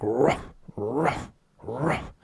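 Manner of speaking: short, soft barked "ruff" with rising pitch; imitated aggressive croak. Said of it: "it got more aggressive".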